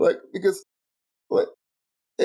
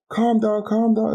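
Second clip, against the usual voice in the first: mocking imitation; 10 semitones higher than usual. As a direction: mimic a smug calming tone, pitched up. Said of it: "he did the whole".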